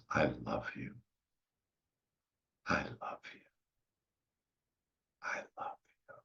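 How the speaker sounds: very soft, fading into a whisper; tender hushed declaration. Acted. Inner child declaring love to the listener, tender fading hush. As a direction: let each repeat sink further toward breath.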